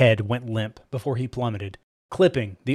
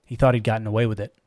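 ordinary delivery, nothing marked

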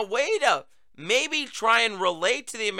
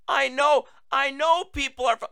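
mimicking Biden; 7 semitones higher than usual. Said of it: "Did you hear that?".

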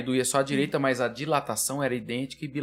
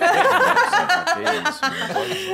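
laughing falsetto, much higher and louder; mock sweet mother; 6 dB louder than usual. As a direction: tease in a giggly high voice. Mocking his mother as sweet.

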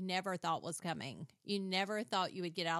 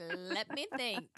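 imitated kids; 3 semitones higher, 4 dB louder, slower than usual. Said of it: "They were like".

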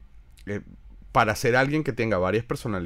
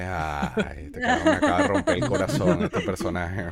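laughing mock heartbreak; 3 semitones lower than usual. Fan laughing to friends about the vegan character, mock heartbreak. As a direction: fake heartbroken wail broken by laughter. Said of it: "Y dije".

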